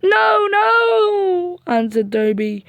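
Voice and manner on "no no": frightened cry. Acted. Toby crying out in fear.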